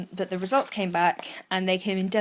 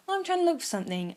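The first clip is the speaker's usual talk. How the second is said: high, quick retold excuse; airy excuse; 9 semitones higher, much faster than usual. Chica alone by the sea making an excuse, airy. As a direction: casually brush off a friend, lightly acted. Character brushing off a worried friend.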